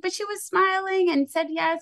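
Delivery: incredulous. Retold or imitated. Protesting the lunch lady's reversal.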